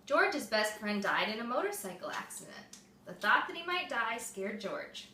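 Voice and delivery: usual speaking voice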